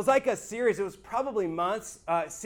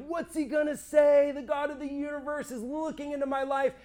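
higher, anxious outburst; tense, fearful cry; fearful anticipation; 6 semitones higher than usual. Retold or imitated. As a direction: blurt out the fear of being seen. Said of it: "waiting with expectation and tremendous fear".

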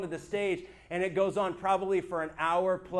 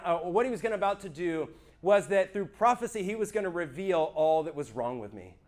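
ordinary talk, nothing marked

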